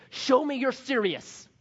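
high, loud mock demand; demanding legalist. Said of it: "and say".

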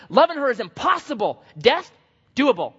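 loud, high acted exasperation; exasperated protest. Angry husband rejects the advice to love her, exasperated protest.

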